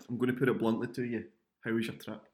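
ordinary talk, nothing marked

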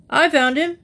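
high, loud report, puffed; breathing heavily. A narrator's reading. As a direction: blurt it out while puffing. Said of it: "breathing heavily".

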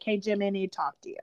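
fast hushed whisper to Jimmy. Retold or imitated. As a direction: whisper it quickly, urgently. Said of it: "Armstrong walks in and is like".

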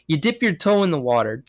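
ordinary talk, nothing marked

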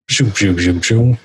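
quick repeated mechanical whooshes; vocal robot-transforming sound effect; imitated transforming noise. Transformer changing shape, imitated zooming noises.

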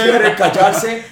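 loud, high shout with laughter; hammed-up shouted scolding; mock yelling. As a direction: echo a comic holler at noisy dogs. Echoes mock yell at dogs.

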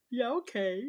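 high, loud acted yes; mock agreement. Host mimicking a colleague accepting shared classes, mock agreement.

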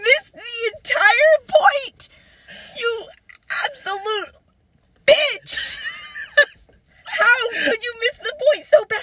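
high, drawn-out outraged wail; slow, high cry of disbelief at being misunderstood; exasperated rant. Acted. Ranting at the absent ex.

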